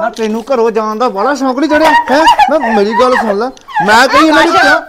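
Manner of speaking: husband shouting at his wife during the fight. Acted. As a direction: blow up at her at full volume. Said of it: "ਕਿਉਂ ਇੰਨਾ ਰੌਲਾ ਪਾਏਗਾ".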